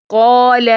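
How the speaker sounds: "قال" held long with a heavy, rounded "aw"-like alif; full-letter heavy vowel. Shown as a demonstration. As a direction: recite "قال" full and level, stretching the vowel. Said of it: "پر حروف کے لیے ٹال کا". ("قال" موٹی آواز میں لمبا کر کے)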